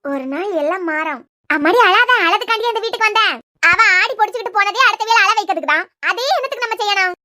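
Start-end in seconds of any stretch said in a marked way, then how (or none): 1.5-7.1 loud, firm talk-down against crying; forceful scolding. Acted. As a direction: push her hard to stop crying. Firmly scolding her not to cry.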